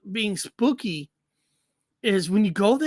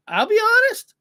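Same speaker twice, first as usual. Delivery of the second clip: I'll be honest, much higher and louder; emphatic repeat; 12 semitones higher, 6 dB louder than usual. Shown as a demonstration. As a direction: blurt honest out high and loud. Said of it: "repeated for emphasis".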